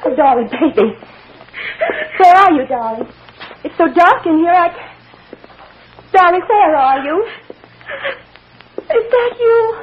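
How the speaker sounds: high, loud, distressed calling; anxious searching. Acted. Mother looking for her child in the dark, anxious calling. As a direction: cry out for a child you can't find.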